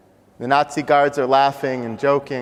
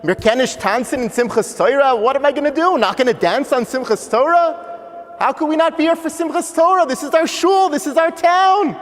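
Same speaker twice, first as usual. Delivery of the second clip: put-on high-pitched child protest, climbing higher; distraught boy; 13 semitones higher, 4 dB louder than usual. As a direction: cry out in dismay, building to a loud peak. Boy protests to his father, upset.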